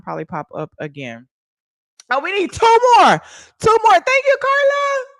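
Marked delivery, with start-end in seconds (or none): 2.1-5.1 high, loud, urgent shout; excited countdown. Real, not acted. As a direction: call out the count as the goal nears. Countdown to the goal.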